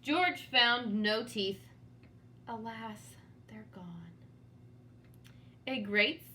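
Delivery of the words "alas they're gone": hushed, breathless whisper of despair; whispered grief. Acted. George whispering alone that his teeth are gone. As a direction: whisper it like all hope is gone.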